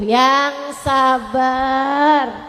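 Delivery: "yang sabar" stretched very slowly; drawn-out advice. Shown as a demonstration. Ustazah answering complaining women, very slow. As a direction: drag out "yang sabar" patiently. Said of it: "Ustazah, suami saya galaknya".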